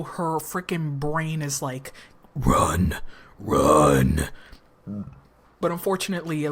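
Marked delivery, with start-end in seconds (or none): 2.4-4.3 very deep, loud growl; drawn-out low, loud command; inner warning voice. Acted. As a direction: boom it out low like a dread voice in the head. Her brain voiced as warning.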